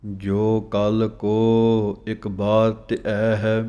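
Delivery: slow, measured chanting of scripture; slow Gurbani recitation. Shown as a demonstration. Teacher reciting the verse slowly for students to follow. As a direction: recite each phrase slowly with pauses.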